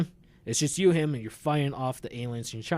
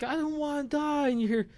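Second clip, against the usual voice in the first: mock panicked screaming; 12 semitones higher than usual. Imitating screaming people in game.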